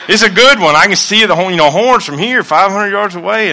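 high, loud and eager, imitating his son; excited son. Son telling dad about the big buck, high and loud with excitement. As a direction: pump it up like a kid spotting a trophy buck.

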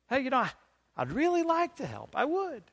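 high-pitched, mock-reluctant excuse; excuse-making. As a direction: imitate someone weaseling out, voice pushed way up. Mimicking someone making excuses.

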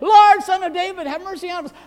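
high, full-voiced desperate shout; beggars' loud plea, pitched high. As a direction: cry out over the crowd's objections. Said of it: "they only shouted louder".

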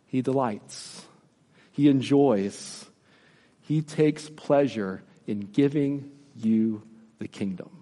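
slow and soft, savouring each phrase; tender. Real, not acted. Tender aside on God's pleasure.

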